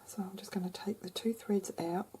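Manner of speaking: whispering. Real, not acted. Whispered crafting aside.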